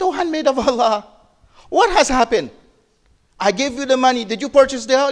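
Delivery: high-pitched puzzled questioning; retold surprise, voice lifted well up; concerned surprise. Prophet asking the maid why she is still there, concerned surprise.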